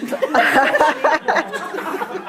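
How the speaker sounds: rising giggle fit; real helpless laugh; burst of laughter. Real, not acted. Reader breaks into laughter.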